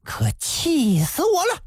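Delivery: high-pitched outburst of rage; furious cry. A narrator's reading. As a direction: explode with anger. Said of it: "大叫一声". (气愤大叫)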